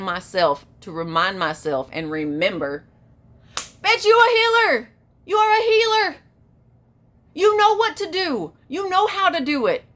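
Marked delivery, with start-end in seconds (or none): 3.6-9.8 high, loud, emphatic rallying cry to yourself; hyped self-pep talk. Acted. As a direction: hype yourself up hard, pitch way up. Rallying herself with remembered self-talk.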